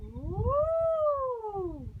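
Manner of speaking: ooh rising high then falling; mountain vocal glide. Shown as a demonstration. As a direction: slide up a mountain and back down. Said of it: "an upside down V".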